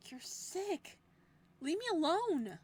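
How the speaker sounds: high, loud frightened shout; fearful shout. Acted. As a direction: shout at him in fear. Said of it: "I shout".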